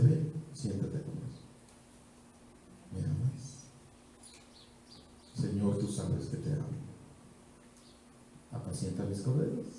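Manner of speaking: hushed dialogue. Acted. Jesus quietly questioning Peter over breakfast, hushed. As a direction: let it sink to near silence.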